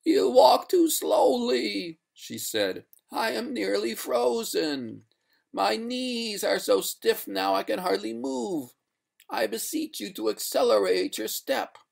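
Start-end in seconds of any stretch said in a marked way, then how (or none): 0.0-2.0 high, loud, slow wail; distressed ghost. A narrator's reading. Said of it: "the water ghost showed signs of distress". 3.1-11.8 high, wailing plea; distressed ghost. A narrator's reading. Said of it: "the water ghost showed signs of distress".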